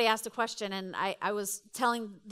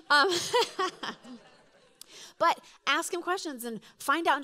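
talking through a laugh; 4 semitones higher than usual. Real, not acted. Jokes then resumes.

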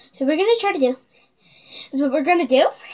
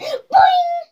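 high boing sound effect; 13 semitones higher, 6 dB louder than usual. Retold or imitated. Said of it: "And she went".

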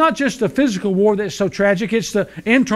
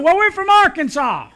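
much higher wife voice; wife answering the attendant, much higher; hard-of-hearing wife; 12 semitones higher, 4 dB louder than usual. Acted. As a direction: pitch her up into the wife's voice.

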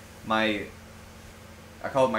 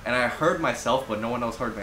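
usual speaking voice

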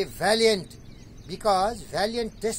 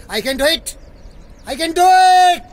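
confident belief; 9 semitones higher, 12 dB louder than usual. Acted. Reciting the doer's belief to his guest, building triumphant shout. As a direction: proclaim self-belief, rising to a shout. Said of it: "because he believed".

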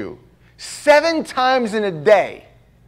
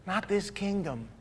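soft firm reply; 16 dB quieter than usual. Retold or imitated. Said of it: "Peter's just like".

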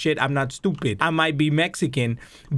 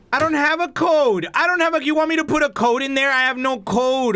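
high-pitched, whiny protest; worked-up, raised-pitch griping; exasperated complaint; 7 semitones higher than usual. Real, not acted. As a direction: protest in frustration. Frustrated by locked code panel.

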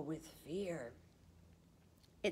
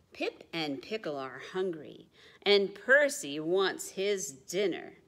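ordinary delivery

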